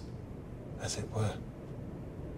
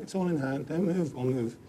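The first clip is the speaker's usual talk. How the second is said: ordinary talk, nothing marked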